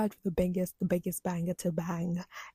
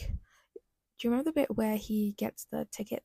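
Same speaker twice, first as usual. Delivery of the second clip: ordinary talk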